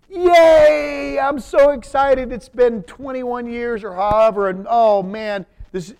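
high, loud mock celebration, hammed up; gleefully cheer, then catch yourself. Preacher mocking a gleeful David over Saul's death, high mock cheer.

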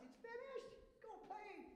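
high, joyful voicing of Jesus; Jesus from the cross. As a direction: toss it off joyfully like the victory is done. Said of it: "That's what he said on the cross".

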